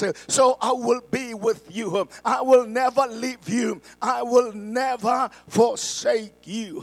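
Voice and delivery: lifted pulpit scripture reading. Real, not acted. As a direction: proclaim the verse to the congregation. Reads scripture in raised voice.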